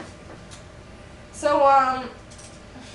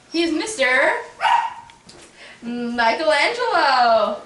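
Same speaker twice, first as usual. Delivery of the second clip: grand guest announcement; 5 semitones higher, 6 dB louder, slower than usual. Acted. Introducing a very special guest.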